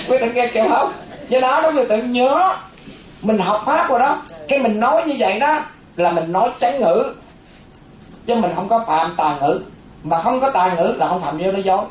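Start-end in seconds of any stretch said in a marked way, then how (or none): none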